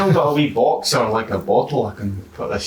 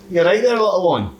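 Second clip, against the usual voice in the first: put-on coaxing child voice; 8 semitones higher, 3 dB louder than usual. Talking softly to a hidden kenku child.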